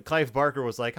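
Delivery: ordinary talk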